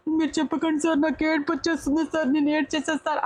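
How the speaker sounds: high, whiny voice on the verge of tears; mock tearful plea. Contestant begging the host to stop, in a high mock-tearful whine. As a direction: play the contestant about to cry, begging.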